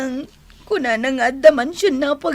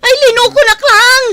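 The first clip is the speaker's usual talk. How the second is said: loud tearful wail, high and shaking; crying outburst; 8 semitones higher, 9 dB louder than usual. Acted. Wife weeps loudly at her husband's empty promises. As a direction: sob it out at full voice.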